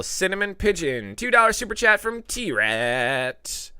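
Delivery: usual speaking voice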